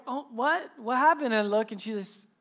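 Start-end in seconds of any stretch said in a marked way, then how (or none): 0.1-1.3 higher-pitched, a touch of worried surprise; mock alarm. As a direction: play the startled dad. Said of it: "I'm like".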